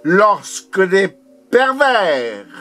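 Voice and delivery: high, forceful poetic outcry; insistent, high-pitched recitation; impassioned declamation. Acted. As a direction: proclaim it with rising intensity. Poem line repeated more intensely.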